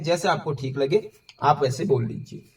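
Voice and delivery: usual speaking voice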